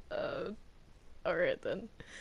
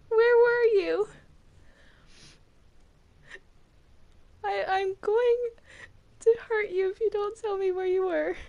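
high, loud, near crying; tearful threat; 9 semitones higher, 6 dB louder than usual. Acted. Allison to Alice with an axe, a tearful loud threat. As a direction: threaten her, voice cracking with emotion.

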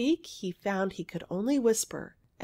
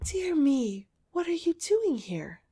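put-on high-pitched astonishment, little girl's question; great surprise; 5 semitones higher than usual. Girl on the wall spots a stranger, great surprise. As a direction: sound properly taken aback, pitch up. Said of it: "in a tone of great surprise".

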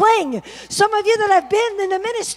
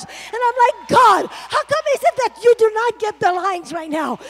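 preaching at full force; 3 semitones higher than usual. Acted. Preacher crying out to God over empty churches, shouting. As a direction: preach it out, pushing harder each line.

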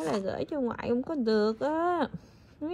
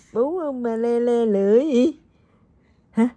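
normal talking voice

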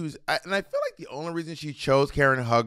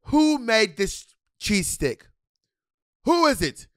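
slow, higher mock-British chef demand; lightly voiced stern chef asking who cooked it; imitating Gordon Ramsay; 7 semitones higher, slower than usual. Imitating Gordon Ramsay demanding who made the cheese stick, slow and higher. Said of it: "He's like, he's like".

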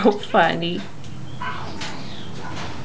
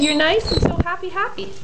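high quick parrot voice; parakeet chatter; 8 semitones higher, 4 dB quieter, faster than usual. Retold or imitated. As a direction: mimic the bird's chatter. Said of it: "He said".

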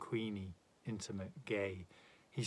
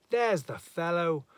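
loud, raised cry of discovery; shrieking; 6 semitones higher, 9 dB louder than usual. A narrator's reading. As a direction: shout it out, high and sudden. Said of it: "and they shrieked".

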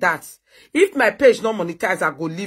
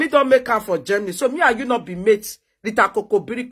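ordinary delivery

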